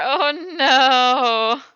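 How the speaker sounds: loud laughing cry; high, loud, laughing oh no. Real, not acted. Laughing reaction, crying sound.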